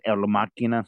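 Machina said the Spanish way, El Machina with a hard k; Spanish accent. Shown as a demonstration. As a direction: say Machina as mah-KEE-nah, Spanish style. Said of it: "if you want to put in the Spanish, uh, accent".

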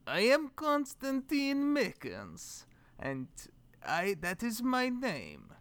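put-on slow, deliberate Russian accent. Trying out a Russian host introduction cold, slow Russian accent. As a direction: try a careful Russian accent, drawn out. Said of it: "what it would sound like in a Russian accent".

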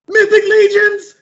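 high, loud, thrilled yell; excited shout. Retold or imitated. Friend opening the bag, shouting with joy. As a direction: shout it out in delight.

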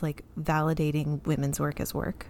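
ordinary delivery

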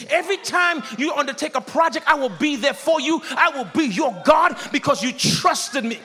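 loud, high, driving sermon climax; preach. Real, not acted. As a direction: proclaim it with full preaching force. Climax of sermon at full force.

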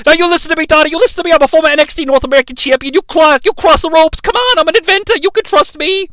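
high-pitched, urgent pleading; mock argument. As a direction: insist in a hammed-up high voice. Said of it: "they have an argument while they're crossing ropes".

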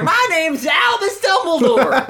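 high hammy southern twang with laughter; southern Reverend. Acted. Co-host reading the Reverend as a hammy southern twang. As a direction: ham up a folksy country preacher.

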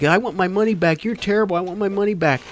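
high-pitched imitation of an aggrieved customer; mock heckler complaint. Retold heckler demanding a refund.

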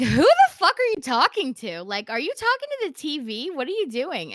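high, loud yell to someone in the next room, easing off; acted-out yelling, loudest at the start; retold yell. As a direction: re-enact an indignant yelled question. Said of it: "and I yell to her".

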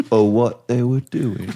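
put-on slow Spinal Tap-style British accent; imitated British accent. Guest quoting a line from Spinal Tap, slow imitated British accent. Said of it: "imitating someone doing a British accent".

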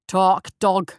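a judge leaning on the coffin, snapping an order; harsh command. Acted. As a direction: bark it down at a prisoner. Judge presses coffin on captive.

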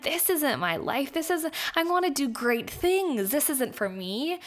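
reliving the moment she knew this wasn't for her; determined realization. Retold or imitated. Quoting her own realization.

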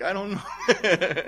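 high, drawn-out words breaking into a laugh. Acted. Preacher playing a clueless counsel-giver for the congregation, laughing. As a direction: joke helpless cluelessness, laughing through it.

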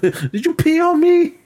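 high, slow shrieked question; hammed-up outrage; mock outraged squeak. Retelling Money Mike waking up, high slow outraged squeak.

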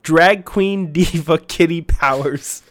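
loud, hyped-up promo announcement; announcer read. Acted. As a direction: announce it as the big headline act. Announces game of the year.

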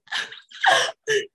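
high-pitched mock gasps of crying; choked-up gasping. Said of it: "I'm being choked up, and I'm like".

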